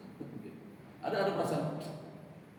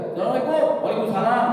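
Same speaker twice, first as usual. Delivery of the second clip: loud, high, clipped "Waalaikumsalam"; short salam thrown back loudly; curt reply; 9 semitones higher, 11 dB louder than usual. Shown as a demonstration. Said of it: "nggak boleh".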